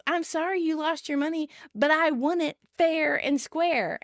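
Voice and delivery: sarcastic, slowing at the end. A narrator's reading. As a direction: fake an apology, then drag out the gloat. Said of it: "Ross sarcastically says".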